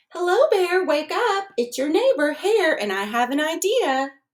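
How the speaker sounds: high, loud wake-up call. A narrator's reading. Hare calling at Bear's door, high and loud. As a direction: rouse a sleeping bear cheerfully. Hare waking the sleeping bear.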